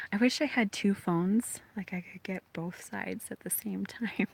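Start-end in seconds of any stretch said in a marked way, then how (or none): 1.8-4.3 whispered aside. Real, not acted. Whispered aside while filming.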